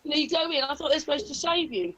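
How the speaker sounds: ordinary delivery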